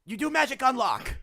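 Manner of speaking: high, loud goblin questioning. Acted. Goblin pushing Jericho about magic on the lock. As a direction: press him like an eager goblin.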